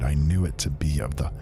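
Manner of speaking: ordinary delivery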